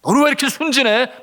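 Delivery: loud, high tempter's jab; Satan's taunt. Retold or imitated. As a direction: throw it out as a mocking accusation. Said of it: "그와 같은 속삭임으로".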